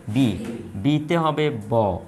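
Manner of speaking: normal talking voice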